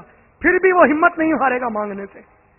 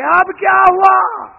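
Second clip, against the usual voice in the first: loud, slow, raised question; slow divine shout; 5 semitones higher, 5 dB louder, much slower than usual. Acted. God asking the begging man a third time, loud slow call. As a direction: call it out slowly from on high.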